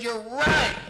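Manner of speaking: loud, high retort; emphatic agreement. Retold or imitated. Therapist answering client's accusation, loud emphatic agreement. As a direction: agree at full volume, as a comeback.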